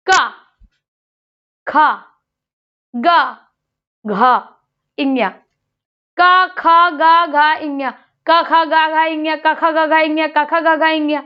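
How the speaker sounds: का खा गा घा इन्या recited syllable by syllable; sounding out letters. Shown as a demonstration. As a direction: recite the consonant row for the class. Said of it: "अब हम इनका उच्चारण करें". (कक्षा को वर्णमाला का उच्चारण करके दिखाना)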